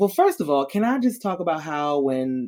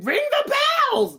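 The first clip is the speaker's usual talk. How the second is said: much higher, yelled command; retold scream. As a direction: mock-scream it like soldiers begging. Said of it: "they were screaming".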